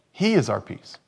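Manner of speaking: ordinary talk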